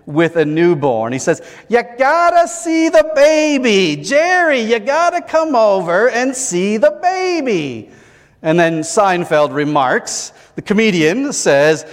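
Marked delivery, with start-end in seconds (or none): 1.7-7.9 put-on high, whiny plea to come see the baby; whiny New York friend. Seinfeld's friend begging Jerry to see the newborn, whiny and high. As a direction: nag like a proud new parent in a New York whine. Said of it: "in his whiny New York voice, Seinfeld, uh, imitates a friend".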